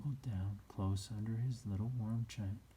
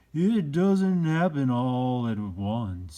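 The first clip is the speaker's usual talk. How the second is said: put-on slow, loud and higher, an old toy's gentle wisdom; wise old Skin Horse; 4 semitones higher, 11 dB louder, much slower than usual. A narrator's reading. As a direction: speak as the patient old horse, drawing it out. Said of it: "said the Skin Horse".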